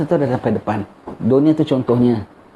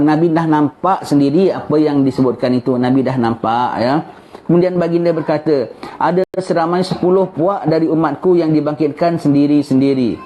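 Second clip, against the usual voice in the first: ordinary talk, nothing marked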